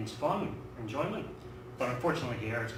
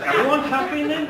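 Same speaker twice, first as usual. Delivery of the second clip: laughing; 13 semitones higher, 11 dB louder than usual. Real, not acted. Laughing through own punchline.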